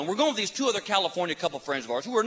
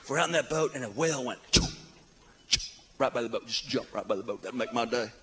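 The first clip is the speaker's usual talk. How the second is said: lazy surfer voice; 7 semitones lower, 5 dB quieter than usual. Retold or imitated. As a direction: play the stoned surfer buddy. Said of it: "Surf Boy".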